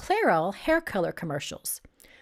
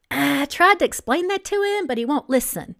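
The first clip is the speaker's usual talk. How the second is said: flustered; 4 semitones higher, 5 dB louder than usual. Acted. Flustered employee reports angry client.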